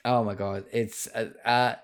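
ordinary talk

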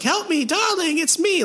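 very high-pitched imitation of a pleading woman; mock plea. Undead woman begging her darling for help, high mock plea. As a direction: plead in a put-on falsetto.

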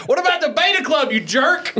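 high teasing heckle, laughing; yelling. Pretend. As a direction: shout it like a playful heckler. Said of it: "I wanted to yell from the back of the room".

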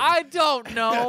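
high, drawn-out exasperation; exasperated. Real, not acted. Recapper asked how a rent sign reminds him, exasperated. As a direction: stretch it out high, at a loss. Answering challenge about plot.

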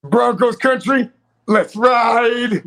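loud, high, drawn-out holler; mock rallying cry. Host belts out the Broncos catchphrase, loud mock rallying cry. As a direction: belt out the slogan, stretching the words.

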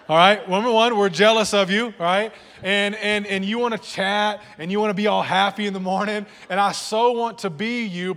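mock-indignant preacher riff; mock rant. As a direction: playfully rail at happy people. Comic rant about morning people.

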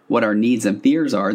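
usual speaking voice

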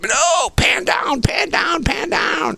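high, urgent imitated yell; mock frantic shout, stretched and high; frantic director. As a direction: bark repeated orders in a high-pitched panic. Director orders through headset.

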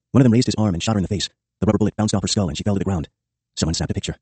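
plain reading voice

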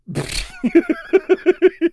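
long high giggle; high laugh. Real, not acted. Laughing at the replay.

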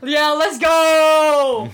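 slow, loud victory shout; triumphant shout. Real, not acted. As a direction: drag out a loud cheer. Celebrating being proven right.

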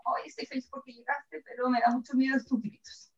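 softly voiced meek little dog; a hint of a timid, appeasing pet. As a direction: speak small and soft as a cowed dog. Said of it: "el perro no se pone chiquitito".

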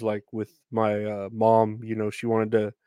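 ordinary delivery

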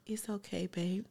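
very soft, soothing murmur; hushed, tender reassurance; gentle consoling. Retold or imitated. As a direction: imitate a partner hushing someone tearful. Partner consoling her as she cries.

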